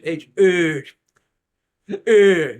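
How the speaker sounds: loud garbled grunts of a clerk on the phone; mock British clerk. Clerk spelling a shop name on the phone, loud mock grunts. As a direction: bark out muddled letters.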